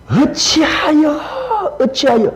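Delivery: slow, high, lamenting 어찌하여 drawn out; lamenting sigh. Shown as a demonstration. Pastor demonstrating the lament to the congregation, high and slow. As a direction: cry out 어찌하여 as a sigh of grief. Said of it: "탄식 기도".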